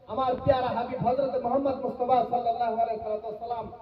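ordinary delivery

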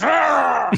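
big bad wolf's growl; comic growl as the wolf pounces; mock wolf growl.

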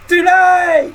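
mock heartbroken scream of her name; mock shout. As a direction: scream the name like a dumped man in a mocking bit. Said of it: "diye de bağırdın mı?".

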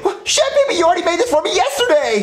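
high-pitched disbelieving shout; incredulous protest. Acted. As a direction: protest it, utterly baffled. Boy protests the day is repeating.

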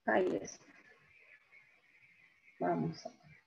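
ordinary talk, nothing marked

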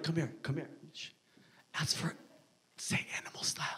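hushed, conspiratorial whisper; secretive whisper. Acted. Insider tipping off a newcomer to a secret menu, whispering. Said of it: "why are we whispering".

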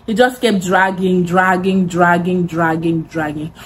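drawn-out dragging, trailing into near silence; fading repetition. Acted. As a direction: let the word drag on and fade out. Repeated word trailing away.

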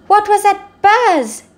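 high, slow, loud puzzled voice; startled zebra. Acted. Zigzag Zebra woken from her snooze, high startled question. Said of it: "she said".

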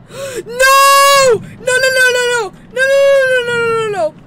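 terrified high shouting; panicked screaming. Real, not acted. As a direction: yell no again and again in fear. Monster attack, panicked.

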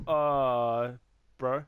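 usual speaking voice

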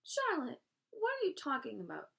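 high, loud disbelief; alarmed cry. A narrator's reading. Wilbur questions Charlotte in alarm, crying out. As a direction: cry out in shock, falling off at the end. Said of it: "he cried".

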